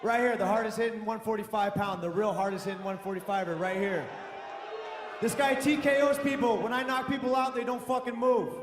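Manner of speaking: high shouted boasting; trash talk. Real, not acted. Fighter hyping himself shouting.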